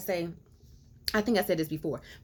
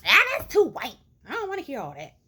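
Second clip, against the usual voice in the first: mock dismissive voice; 6 semitones higher, 5 dB louder, much faster than usual.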